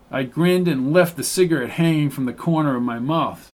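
ordinary delivery

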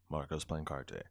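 low mechanical name drop; robot-like. Acted. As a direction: sign off like a droning machine. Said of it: "did I sound like a fucking robot?".

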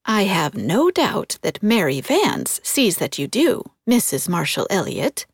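pointed, with swooping emphasis; significant. A narrator's reading. Susan needling Miss Cornelia, significantly. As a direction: make it a meaningful dig. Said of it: "said Susan significantly".

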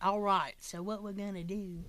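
soft, badly faked Australian accent; half-hearted mock Australian; attempted Australian accent. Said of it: "I can't do an Australian accent".

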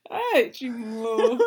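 slow, high, laughing exclamation; drawn-out amused reaction then laugh; amused groan. Real, not acted. Learner reacting to a crude insult, laughing.

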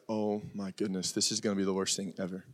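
very low, hushed groaning complaint; mock dread. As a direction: imitate a listener dreading it, under the breath. Voicing listeners dreading the topic.

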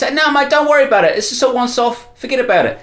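put-on high, dismissive kid's reply; young runner. Runner brushing off the crime boss, high and dismissive. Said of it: "And he says".

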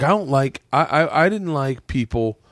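normal talking voice

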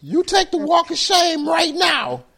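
high, loud, slow command; mock firing order. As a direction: order him out like a tyrant boss. Rich boss firing employee.

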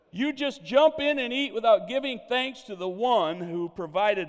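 loud, high declamation to a room; retold rebuke, projected like a public address; preacherly scolding. Evangelist scolds diners for not praying, loud scolding.

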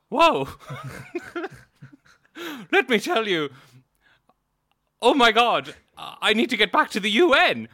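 high, loud hammed-up Mary Robinson; comic high-pitched politician rushing off. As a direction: squeal it out as a frantic Mary Robinson. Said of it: "spot on Mary Robinson voice".

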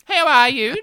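loud chirpy question; bright loud greeting. Acted. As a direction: call it out cheerfully. Cheery greeting.

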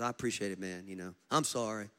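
low, soft imitated apology; quietly sheepish, mimicked; retold repentant apology. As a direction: play him humbled and subdued. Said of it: "He's repenting all the way".

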